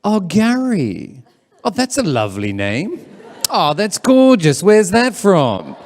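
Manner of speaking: high, drawn-out swooping gush; gushing admirer. Acted. Imagined admirer praising Gary's name, gushing. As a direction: coo over a name, stretching the words. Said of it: "comes up to me and says".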